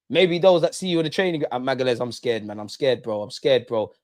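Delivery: ordinary talk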